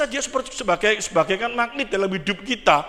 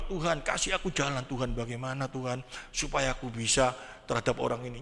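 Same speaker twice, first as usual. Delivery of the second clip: quiet, low, pleading prayer; soft prayer; 8 semitones lower, 5 dB quieter than usual. Retold or imitated. Praying to God about an annoying person, soft and low. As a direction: pray it softly and low, asking for a way. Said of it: "Habis itu berdoa".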